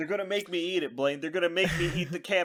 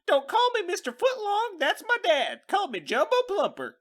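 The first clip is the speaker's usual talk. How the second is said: hammed-up cowboy character; 11 semitones higher than usual. Introducing the made-up character Jumbo Plumper in a high cowboy voice. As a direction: greet the kids like a goofy cowboy star.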